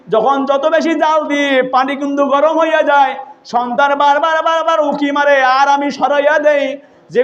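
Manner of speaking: high sing-song sermon chant; melodic preaching. Retold or imitated. Preacher retelling Omar questioning a mother, melodic preaching.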